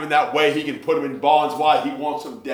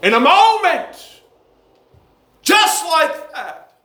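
sudden high exclamation; 12 semitones higher, 3 dB louder, slower than usual. Acted.

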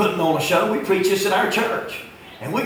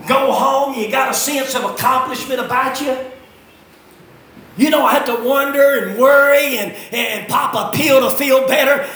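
loud, raised country-preacher cadence; full-force preaching; 8 semitones higher, 6 dB louder than usual. Real, not acted. Preacher exhorting congregation about hard work, full-force preaching. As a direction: preach it hard, punching key words.

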